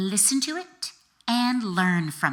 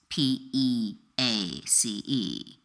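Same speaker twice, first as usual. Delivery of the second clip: slow spelled out; 7 semitones lower, 4 dB quieter, slower than usual. Shown as a demonstration. Spelling out peace.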